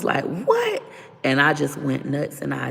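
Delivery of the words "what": a high squeal of disbelief; shocked what. Retold or imitated. Stunned girlfriend hearing he's sick of both women, sky-high squeak. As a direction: leap way up in pitch, stunned. Shocked reaction to his words.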